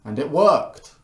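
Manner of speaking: mock amazement; excited. Mock amazed spam reveal.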